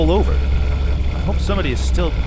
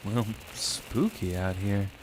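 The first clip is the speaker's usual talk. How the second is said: nervous hush; 9 semitones lower, 13 dB quieter, slower than usual. Acted. Nervous man alone in dark.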